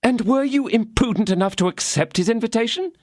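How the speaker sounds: lightly voiced anxious wife; voicing Madame Bonancieux. A narrator's reading. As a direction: press him with worried disbelief.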